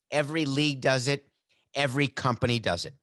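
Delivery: ordinary delivery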